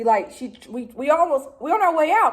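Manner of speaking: ordinary talk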